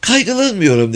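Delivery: high breezy woman's voice; Mrs. Phelps. A narrator's reading. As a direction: wave off worry, pitched high. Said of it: "dedi Bayan Phelps".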